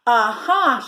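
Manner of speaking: loud aha of discovery; loud triumphant gotcha; triumphant cry. Acted. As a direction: catch him in the act with a loud aha. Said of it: "she cried".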